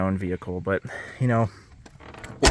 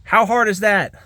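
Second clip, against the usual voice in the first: real frustrated near-shout, higher; louder, higher exasperated outburst; exasperated yell; 8 semitones higher, 4 dB louder than usual. Real, not acted. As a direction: vent it at the seller. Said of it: "sorry to yell".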